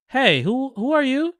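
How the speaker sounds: high, halting retold question; mock startled challenge. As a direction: ask as if caught off guard, imitating. Retold startled stranger walking in.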